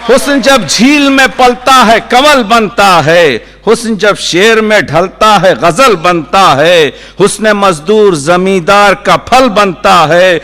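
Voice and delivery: high ringing mushaira cadence, gradually easing down; poetry recitation. Acted. Poet repeating verses on beauty to applause, declaiming. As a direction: declaim each line with a rhythmic lift.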